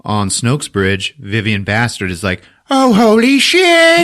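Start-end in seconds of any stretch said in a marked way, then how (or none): 2.7-4.0 high, loud panicked squeal of alarm; panicked yelp. Retold or imitated. Villain yelps at the ship coming at him, loud panic. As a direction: shriek it like a cornered villain.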